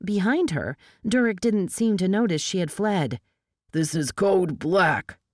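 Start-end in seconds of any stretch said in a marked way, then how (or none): 3.7-5.2 slow, weighty agent's announcement; drawn-out official pronouncement; forceful declaration. A narrator's reading. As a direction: declare it firmly to rival agents. Agent asserts authority in standoff.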